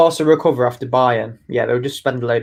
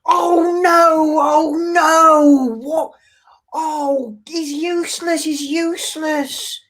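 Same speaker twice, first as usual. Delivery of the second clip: much higher, wailing despair over his team; Mark Goldbridge impression; 14 semitones higher, slower than usual. Asked by his co-host to imitate a fan channel host, high wailing despair. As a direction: whine like a heartbroken fan ranting at the team. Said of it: "do your best Mark Goldbridge impression".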